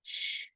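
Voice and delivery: "sh" as a soft hushing hiss; sh sound. Shown as a demonstration. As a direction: breathe out a quiet "sh" with no voice. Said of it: "they make one new sound".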